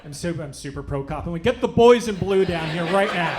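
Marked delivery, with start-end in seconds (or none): 1.4-3.4 loud, laughing, over-the-top demand; joking yell telling the manager to get police over; mock bellowed demand. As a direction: bark it like a self-righteous citizen. Demanding police come now.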